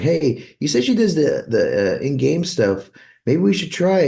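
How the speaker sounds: bursting with the idea, pitching it high; excited pitch. Retold or imitated.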